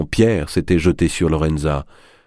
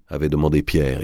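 ordinary delivery

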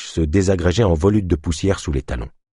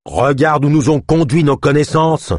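higher, louder, furious outburst; enraged; 8 semitones higher, 4 dB louder than usual. Acted. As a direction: hurl it with rage. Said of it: "La voix de Marlowe était devenue rageuse".

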